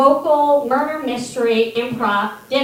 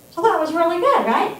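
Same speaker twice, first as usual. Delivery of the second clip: ordinary delivery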